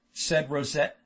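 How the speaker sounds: ordinary delivery, nothing marked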